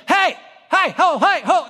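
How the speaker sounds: high-pitched street hawker calls; short, high mock yells from market vendors; imitating yelling vendors. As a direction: holler for a passerby's attention. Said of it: "they just yell at me".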